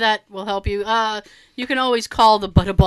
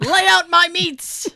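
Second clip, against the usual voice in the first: haughty French chef yell; 7 semitones higher, 4 dB louder, slower than usual. Pretend. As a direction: bark it like a fussy French chef. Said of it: "after I yelled out".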